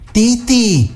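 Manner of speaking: a high, delighted cry of the name; lightly voiced woman exclaiming with joy; delighted exclamation. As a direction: greet an old friend's name with surprise. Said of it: "exclamă ea".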